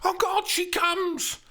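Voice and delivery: high, youthful Juliet voice; girlish Shakespeare heroine, reading; Juliet read aloud. Acted. Steph reading Juliet's lines at rehearsal, high and eager.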